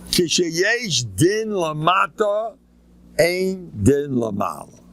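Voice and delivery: slow, measured recitation of a saying; deliberately slow proverb; slow quotation. Shown as a demonstration. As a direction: lay out the saying slowly. Quoting a saying slowly.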